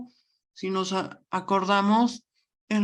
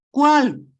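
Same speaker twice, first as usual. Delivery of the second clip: "cuál" punched much higher and louder with a falling tune; stressed cuál; 7 semitones higher, 10 dB louder than usual. Shown as a demonstration. Teacher pointing out the accented word to students, loud stressed "cuál". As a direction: bark out "cuál" as the key word. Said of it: "¿este cuál?".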